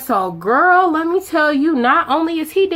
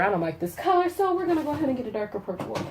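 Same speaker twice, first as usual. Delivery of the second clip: quiet aside; 2 semitones higher, 9 dB quieter, much faster than usual. Real, not acted. Aside to someone off camera.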